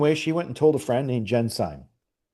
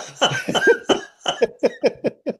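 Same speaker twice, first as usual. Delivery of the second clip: laugh. Real, not acted. Laughing at own story.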